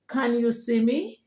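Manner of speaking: native-speaker question climbing on "me"; native English speaker accent. Shown as a demonstration. Teacher models asking like a native speaker, rising native accent. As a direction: native English intonation, lift "me" at the end. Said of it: "sound like the native speaker".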